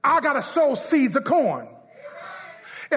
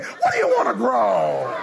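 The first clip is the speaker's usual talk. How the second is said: like you mean it. Shown as a demonstration. Preacher coaching the congregation to say it like they mean it, emphatic. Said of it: "No, say it like you mean it".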